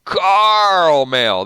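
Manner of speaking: Carl Mail drawn out as "CAAARL-mail"; exaggerated caramel. Shown as a demonstration. Host reads a viewer's joke spelling, Carl Mail as a long "CAAARL-mail". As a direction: stretch the first syllable of Carl Mail high and big. Playful reply on caramel pronunciation.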